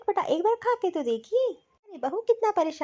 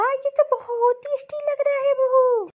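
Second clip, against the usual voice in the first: higher, slow, savouring compliment; delighted praise; 4 semitones higher than usual. Acted. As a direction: draw it out in pleased surprise. Mother-in-law delighted by the soup. (खुश होकर खींचते हुए तारीफ़)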